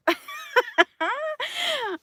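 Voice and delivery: a high burst of laughter; real high-pitched laugh; high laughing fit. Real, not acted. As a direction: laugh it off at your own line. Laughing at her own retort.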